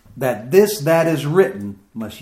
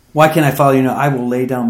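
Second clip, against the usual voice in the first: louder, pressing repeat of the question; loud plea; 6 dB louder, faster than usual. Acted. Preacher voicing Peter, repeating his question loudly. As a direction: push it out like an urgent plea.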